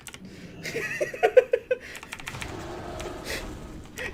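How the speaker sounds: long, very high, breathy wheeze of laughter; long breathless giggle; high wheezing laugh. Real, not acted. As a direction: collapse into a silent high laugh. Cracking up at himself.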